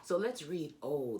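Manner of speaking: ordinary delivery, nothing marked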